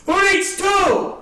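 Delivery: high, loud vendor's yell; imitated yelling. Ticket seller yelling over a comic's set, imitated. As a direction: holler it slowly across the room like a ticket hawker. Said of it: "He's yelling".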